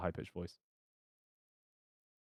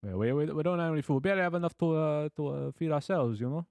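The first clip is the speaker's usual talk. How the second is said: higher-pitched, wobbly mock Italian-American; New York Italian accent; 4 semitones higher than usual. Italian blacksmith pleading he has no food, New York Italian accent. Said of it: "New York Italian".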